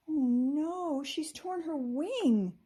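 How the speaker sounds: high, upset and slow; a gasp of worry over the torn wing; gasping in dismay. A narrator's reading. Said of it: "she gasped".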